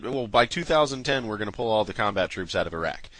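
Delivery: ordinary delivery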